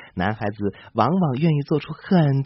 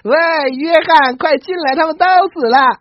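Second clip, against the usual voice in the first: loud and higher, laughing; laughing call; 13 semitones higher, 8 dB louder than usual. A narrator's reading. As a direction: call out gleefully through a window. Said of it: "突然大笑着对窗外说".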